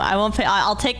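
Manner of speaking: ordinary talk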